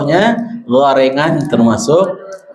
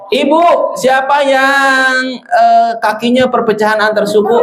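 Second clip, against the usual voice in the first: riddle voice; 8 semitones higher than usual. Acted. Playful riddle question to class.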